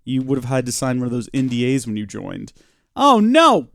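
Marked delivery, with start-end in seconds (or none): 3.0-3.7 high, loud wail of dismay; dismayed cry. Real, not acted. Gamer losing their grass, loud dismayed cry. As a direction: cry out as it goes wrong.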